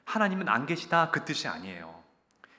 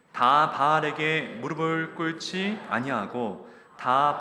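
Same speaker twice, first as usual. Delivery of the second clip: loud, slow unison reading; congregational scripture reading; 3 dB louder, much slower than usual. Real, not acted. Congregation reading Kings aloud together, slow and loud. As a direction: read each word together, slowly.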